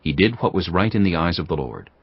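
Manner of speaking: ordinary delivery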